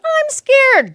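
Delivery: mock scared child. Child telling parent of fear, whining. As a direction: whine it like a frightened kid. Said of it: "child resisting".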